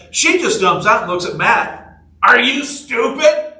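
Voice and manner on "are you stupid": high, drawn-out mock yell; yelling from afar, imitated. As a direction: call it incredulously across a long distance. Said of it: "From, like, fifty feet away".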